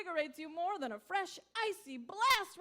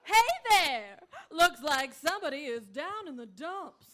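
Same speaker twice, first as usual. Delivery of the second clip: loud, bright ad pitchman voice; cheery pitchman; 8 dB louder than usual. Acted. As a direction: sell it like a TV pitchman. Pitchman enters.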